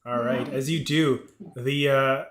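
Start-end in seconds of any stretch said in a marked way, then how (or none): none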